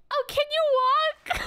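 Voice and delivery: high, slow fairy question; annoying fairy voice. Acted. Fairy checking if the newcomer can walk, annoying high voice. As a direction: ask slowly in a high pesky fairy voice. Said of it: "It has to be an annoying voice".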